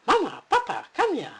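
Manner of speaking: high, loud excited calling; excited little bear. Acted. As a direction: bounce with excitement. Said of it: "Bruno danced with excitement".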